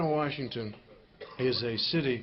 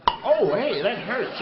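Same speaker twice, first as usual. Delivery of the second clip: higher, louder yelp of pain; hail pain cry; 8 semitones higher, 7 dB louder, slower than usual. Acted. Caveman hit by hailstones cries out in pain.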